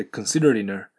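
"considering a" said as "consideri-na" with the G dropped. Shown as a demonstration. Teacher showing students the dropped G. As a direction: drop the G and link "considering" into "a". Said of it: "Gが、飛ばされて".

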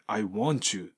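"I want you" as ai won-chu; linked "want you", ending in chu; T-Y liaison. Shown as a demonstration. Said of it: "wantのTとUがくっついて".

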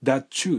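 "that you" linked as dat-chu; "that you" run together, ending in chu; T-Y liaison. Shown as a demonstration. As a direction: merge the T and Y of "that you" into a ch. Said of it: "thatのTで終わって".

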